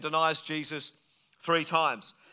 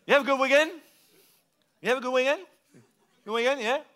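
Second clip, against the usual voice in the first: higher Aussie outback mumble; rural Australian accent; 7 semitones higher, much faster than usual. Shown as a demonstration. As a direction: chat like a country Aussie with lips barely moving. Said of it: "'cause they're from a rural part".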